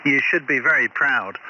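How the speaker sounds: ordinary delivery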